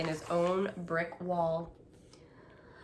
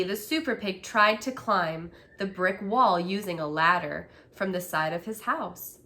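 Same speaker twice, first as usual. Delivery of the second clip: ordinary delivery, nothing marked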